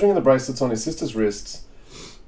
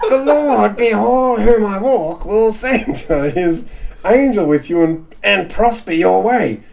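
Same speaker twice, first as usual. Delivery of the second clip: high, slow whining through a laugh; whingey voice; 7 semitones higher, 4 dB louder, slower than usual. Acted. Henchman in a high whingey voice, slow and giggly. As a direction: whine it slowly, nearly laughing. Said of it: "henchman doing a whingey voice".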